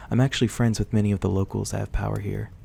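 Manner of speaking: ordinary delivery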